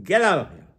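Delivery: loud, high shouted dismissal; emphatic order. Retold or imitated. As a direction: throw them out with a shout. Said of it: "To emphasize".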